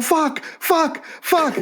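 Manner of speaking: high mock yelps of pain; burning chief yelp. Chief running from his burning hut, high yelps. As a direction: yelp high like he's on fire. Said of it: "'cause he's burning".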